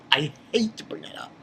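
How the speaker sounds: whispered, embarrassed aside; a real hushed aside. Real, not acted. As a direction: drop to a whisper, sheepish. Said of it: "plain first try".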